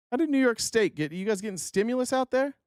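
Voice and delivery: baffled, rising high; incredulous. Real, not acted. Streamer to chat, high incredulous question. Incredulous at donations from New York.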